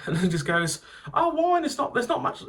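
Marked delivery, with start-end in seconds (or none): 1.1-2.4 put-on high, squealing drunk girl; mock a wine-sozzled student, much higher; tipsy student.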